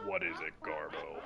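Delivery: low, soft put-on voice; deep host voice. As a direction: ask it dead low. Malloy voice.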